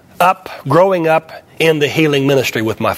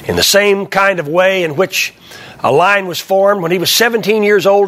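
normal talking voice

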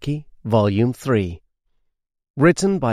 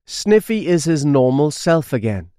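ordinary delivery

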